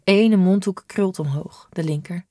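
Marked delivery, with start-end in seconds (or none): none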